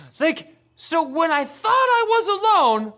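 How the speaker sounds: normal talking voice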